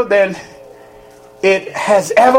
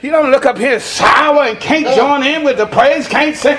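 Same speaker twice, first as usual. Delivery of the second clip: fiery preaching; 7 semitones higher than usual. Real, not acted. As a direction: whoop it up to the congregation. Preaching at full force.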